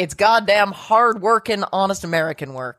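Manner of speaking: hammed-up proud American; patriotic American voice. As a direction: boom it like a flag-waving patriot. Mock patriotic boast.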